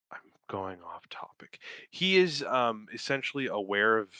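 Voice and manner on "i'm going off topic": whispered aside to self. Real, not acted. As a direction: breathe it like a secret.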